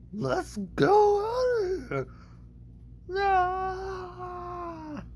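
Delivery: very high, wailing cartoon voice; drawn-out high cry of cartoon fright; Shaggy. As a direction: wail like a scared cartoon sidekick. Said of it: "that was Shaggy and Scooby".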